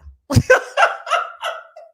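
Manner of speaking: high-pitched burst of laughter. Real, not acted. Host amused that followers have no idea, laughing. Laughs at her own remark.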